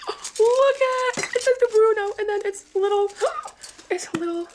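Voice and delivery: high delighted squeals over the gift; excited. Real, not acted. Unboxing a gift for her dog, high excited gushing. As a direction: gush in a high thrilled voice.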